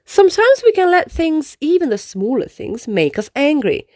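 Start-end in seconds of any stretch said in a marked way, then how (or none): none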